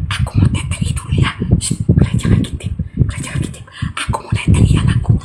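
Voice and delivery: soft breathy mother whisper, fast; hushed mock mother whisper. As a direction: whisper so the baby sleeps. Mother whispers while nursing.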